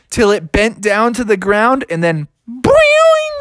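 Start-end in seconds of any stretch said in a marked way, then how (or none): 2.5-3.4 long falsetto boing; cartoon twang, high and drawn out; springy boing. A narrator's reading. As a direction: imitate a catapult springing. Tree springs back again.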